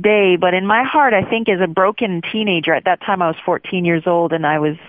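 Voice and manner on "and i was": normal talking voice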